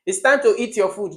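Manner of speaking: loud, high call to the class to eat; calling out like a teacher at mealtime; teacher's call. Retold or imitated. Teacher telling pupils at mealtime, loud and high. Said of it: "you must have heard your teacher say that".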